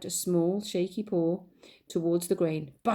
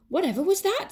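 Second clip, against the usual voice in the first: high startled question; surprised miller; 12 semitones higher, 4 dB louder than usual. Acted. Miller startled by the crash, high surprised cry. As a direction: jump at the crash and ask. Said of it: "said the miller".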